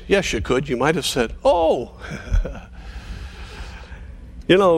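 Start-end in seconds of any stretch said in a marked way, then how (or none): none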